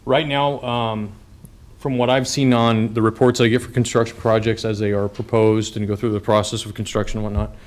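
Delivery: ordinary talk, nothing marked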